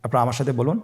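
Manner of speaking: usual speaking voice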